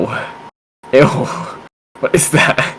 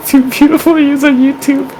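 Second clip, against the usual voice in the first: high-pitched mocking echo. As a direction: parrot it back in a silly high voice. Mocking partner's line.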